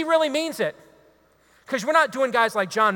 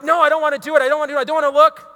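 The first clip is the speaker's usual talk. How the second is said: louder, higher, pleading with himself; higher, louder self-pleading; mock inner struggle; 6 semitones higher, 6 dB louder, much faster than usual. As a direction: ham up a man fighting temptation. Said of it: "And I'm like".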